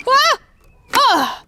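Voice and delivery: ordinary delivery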